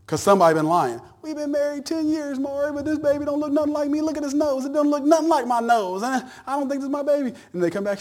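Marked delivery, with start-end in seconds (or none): 1.2-7.3 high, mocking voice of a doubting husband; hammed-up daytime TV guest; mock talk show husband. To Maury, a husband doubting the baby is his, mock high voice. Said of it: "how mad people get on Maury Povich".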